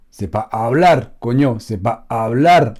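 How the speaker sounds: "hablar" rolled at the end, mocked; wrong rolled final r. Scolding wrong teachers, rolled "hablar" imitated. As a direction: mimic the overdone trill on "hablar". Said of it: "c'est hablar".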